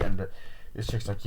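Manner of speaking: ordinary delivery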